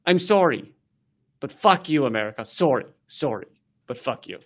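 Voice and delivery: low, soft sorry then fuck you; mock polite Canadian. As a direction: apologize sweetly, then curse under your breath. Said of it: "at the end they go".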